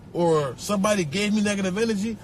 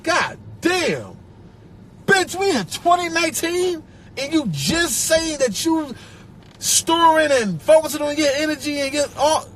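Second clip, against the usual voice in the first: exasperated rant; 6 semitones higher than usual. Retold or imitated. Reacting to people focusing on happiness this year, high exasperated rant. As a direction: let it rip in disbelief. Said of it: "I look back like".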